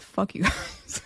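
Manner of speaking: imitating an angry God while laughing. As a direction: giggle through a fed-up god's curse. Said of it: "He's like".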